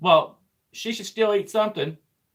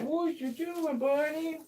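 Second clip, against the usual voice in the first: high sneering official voice; mock a petty bureaucrat, high; evil bureaucrat; 11 semitones higher, 7 dB quieter, slower than usual. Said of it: "You sound like an evil bureaucrat".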